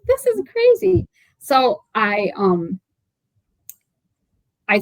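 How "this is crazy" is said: much higher and louder, stunned; shocked disbelief. Retold or imitated. Author recalling learning of the stolen generation, shocked outburst. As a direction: cry out in disbelief. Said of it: "You know, like".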